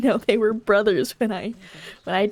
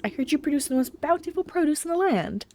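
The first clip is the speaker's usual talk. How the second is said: higher-pitched polite admirer; butter up the farmer, lifted and courteous; flattering visitor; 7 semitones higher than usual. Acted.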